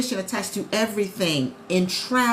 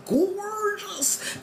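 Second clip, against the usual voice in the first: high, long drawn-out gushing; gushing awe; 10 semitones higher, 3 dB quieter than usual. Acted. Seeing a brand new house from outside, gushing. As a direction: stretch the word out high, in show-off awe.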